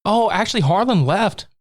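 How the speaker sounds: high sing-song gloating; mock-innocent high mother voice; sweet fake mother. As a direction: lilt it high and falsely sweet.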